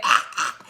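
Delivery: short unvoiced bird squawks; imitated bird call. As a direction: mimic a small bird's repeated cry. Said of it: "making that little sound".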